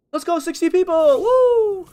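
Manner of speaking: loud, high celebratory whoop; excited cheer. Real, not acted. Streamer celebrating sixty people, excited shout. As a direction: cheer it at the top of your voice.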